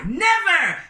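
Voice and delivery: high, loud defiant cry; defiant shout. Acted. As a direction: fling out a furious refusal. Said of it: "the vendor said".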